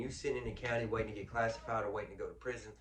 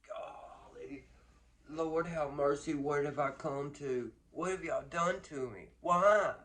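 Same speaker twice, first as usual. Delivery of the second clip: slow, rising lament to the Lord; mock despair; 4 semitones higher, 6 dB louder, slower than usual. New inmate arriving at prison, despairing cry to the Lord. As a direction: plead with heaven, hammed up. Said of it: "You're like".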